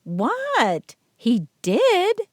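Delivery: high surprised voice; mock surprise. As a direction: feign innocent surprise. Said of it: "he hissed".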